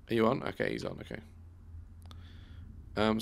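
normal talking voice

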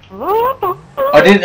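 high-pitched panicky blurt; panicked apology. Real, not acted. Panicked apology after an accidental kill.

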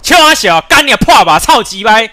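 loud, angry rage at the game; furious yelling. Real, not acted. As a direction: yell it out in real fury. (气急败坏地怒吼)

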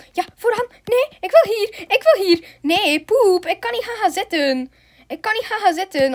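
high-pitched agitated protest; squabbling protest. Real, not acted. Player fighting for a front seat, high agitated protest. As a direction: insist on your seat, pitched well up. Squabbling over where to sit.

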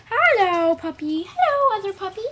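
high, loud singsong hello; high puppy greeting. Real, not acted. Girl greeting her two puppies in high baby talk.

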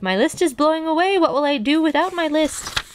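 high-pitched dismay, voiced for a story; distressed cry. A narrator's reading. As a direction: wail in mild panic, pitch held high. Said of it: "cried Toad".

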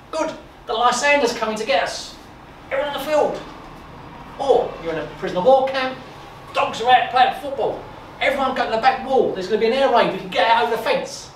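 loud and high, fired up; passionate. Real, not acted. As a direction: rush it with excitement. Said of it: "you can hear my passion of it".

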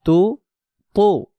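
তু said high, loud and thick; heavy thick tu. Shown as a demonstration. Teacher drilling the heavy তু, loud and thick. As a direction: punch a heavy তু. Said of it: "মোটা আওয়াজে পড়তে হবে".

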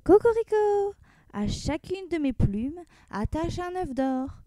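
high crow, then slow demand; rooster crying out. Acted. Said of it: "il s'écria".

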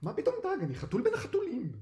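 very high, panicked cat protest; frightened, indignant squeal; alarmed. A narrator's reading. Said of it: "קרמר נבהל".

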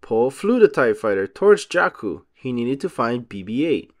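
plain reading voice

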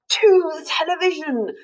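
high rallying cry; cried in unison. A narrator's reading. Children rushing to the TV, high rallying cry. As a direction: call it out together like a charge. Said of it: "they all cried in unison".

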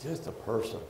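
low, hushed, weighed-down confession; subdued and halting, softly confiding; heavy-hearted. Real, not acted. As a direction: let the voice sink under a burden. Confesses a heaviness on him.

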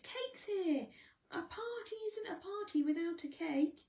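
high excited cheering; cheering crowd. Acted. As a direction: call out with joy. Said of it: "they cheered".

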